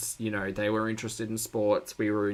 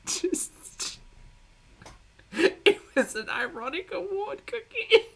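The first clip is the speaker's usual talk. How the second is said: laughing; slower than usual. Real, not acted. Host laughs at the award.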